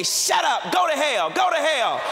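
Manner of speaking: put-on loud high shouting kid; child's tantrum. Preacher imitating a child's tantrum in the store. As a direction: yell it like a raging child. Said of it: "look at me and say".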